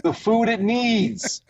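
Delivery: drawn-out high commercial read; slow ad read. Retold or imitated. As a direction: stretch each word like a pet-food ad. Acting out ad read.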